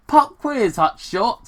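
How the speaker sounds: high, loud gleeful movie quote; quoting the movie. Acted. Sonic quoting his favourite line, high and loud. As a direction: savour the classic line. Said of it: "Sonic quoted".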